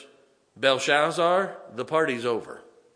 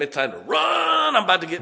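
mock panic; 6 semitones higher, 6 dB louder than usual. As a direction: yell it like a kid who must flee. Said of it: "Mom just used the middle name".

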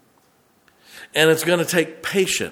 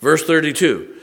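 usual speaking voice

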